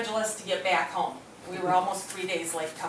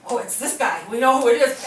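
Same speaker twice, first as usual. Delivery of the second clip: excited; 3 semitones higher, 8 dB louder than usual. Retold or imitated. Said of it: "Lisa jumps on the computer and goes".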